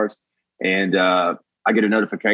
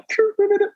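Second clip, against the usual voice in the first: very high-pitched mouth noise; sudden squeaky trilled noise; weird sound effect. Acted. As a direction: imitate an actor's odd vocal sound effect. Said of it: "like, weird sound effects".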